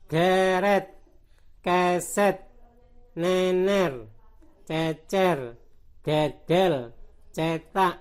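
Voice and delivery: words like "geret" with open è, "eh"; teacher's list drilling è as in "nener"; open E group. Shown as a demonstration. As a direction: read each word loud and split, "keset" as keh-set. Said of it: "ini ternyata enak dibaca E".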